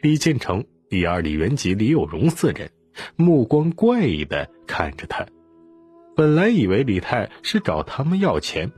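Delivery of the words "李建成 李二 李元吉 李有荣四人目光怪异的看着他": ordinary delivery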